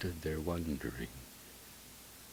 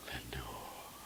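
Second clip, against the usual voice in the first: faint whispered echo, lower and softer; murmured echo; 4 semitones lower, 12 dB quieter than usual. Acted. The empty dark answering back, whispered echo. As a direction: let it fade like an echo. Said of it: "an echo murmured back the word".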